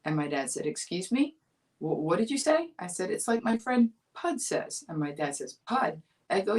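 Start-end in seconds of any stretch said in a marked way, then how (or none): 0.7-2.7 higher, puzzled dad asking back; a father pretending not to catch it. 3.1-4.8 put-on higher, earnest little-girl voice; a first grader explaining seriously; innocent child self. Child self repeats to dad. 5.7-6.0 higher, amused dad asking back; a father fishing for the name again; dad questioning. Retold or imitated.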